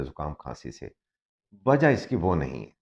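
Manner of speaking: usual speaking voice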